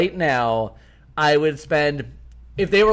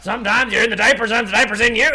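folksy voice; 7 semitones higher, 4 dB louder, much faster than usual. Retold or imitated. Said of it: "It would sound kind of folksy".

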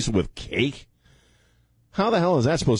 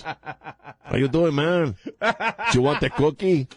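George Lopez impression; 8 semitones higher than usual. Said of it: "Played by George Lopez".

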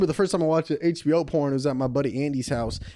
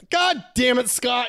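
exasperated parent; 7 semitones higher, slower than usual. Acted. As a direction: yell at the culprit like a fed-up parent.

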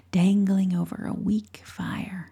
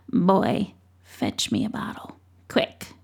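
croaky witch; 8 semitones lower than usual. Acted. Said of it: "a croaky old voice".

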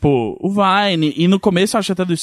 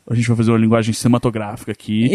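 pompous filmmaker voice; 8 semitones lower than usual. Retold or imitated. Mocking pretentious filmmakers.

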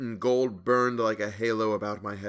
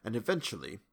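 softer, calmer start; more quietly; 6 dB quieter than usual. A narrator's reading. Narrator calms himself, speaking more quietly to Hawberk. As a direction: pull the voice back down. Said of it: "I continued more quietly".

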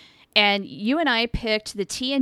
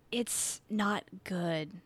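flat quiet verdict; 11 dB quieter, much slower than usual. Real, not acted. Blunt verdict on the episode.

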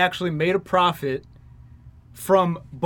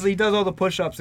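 ordinary talk

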